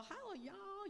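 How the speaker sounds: high mock southern drawl on Hello, y'all; a hammed-up southern drawl, like a Texan greeting Chinese students. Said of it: "you come up with a southern drawl".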